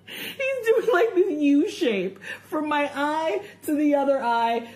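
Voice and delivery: breaking into laughter mid-story; giggling through the retelling; laughing while telling. Real, not acted.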